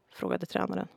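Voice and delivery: plain reading voice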